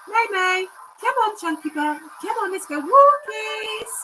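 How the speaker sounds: high, loud sing-song dog owner calling; slow, drawn-out "walkies" to tempt the dog; coaxing pet talk. Acted. Calling the dead dog for walkies, high coaxing pet voice.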